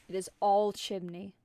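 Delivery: normal talking voice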